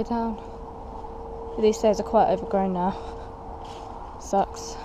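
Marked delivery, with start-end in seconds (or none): none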